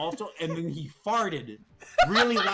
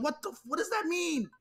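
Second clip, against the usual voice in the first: much higher, incredulous question; baffled disbelief; 11 semitones higher than usual. Retold or imitated. Player asking Brisbane about his slang, baffled disbelief. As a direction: pitch it up in mock bewilderment.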